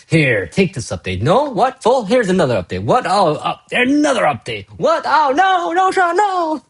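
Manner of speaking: rising, squealing back-and-forth, ending in high desperate "no, Sean, no"; mock panicked fan voice; acted meme dialogue. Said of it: "I love that meme".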